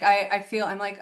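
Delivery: ordinary talk, nothing marked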